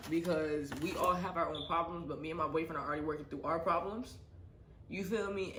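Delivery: ordinary talk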